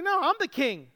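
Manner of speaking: a hint of an angry king; mock angry retort. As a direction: bristle like a king refusing to step down. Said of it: "He's angry".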